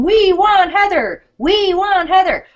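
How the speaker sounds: high, drawn-out chant of viewers; mock crowd yell. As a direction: imitate a crowd calling out, stretched and high. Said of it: "everyone's yelling".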